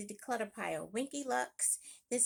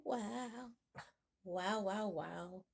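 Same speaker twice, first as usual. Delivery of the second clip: usual speaking voice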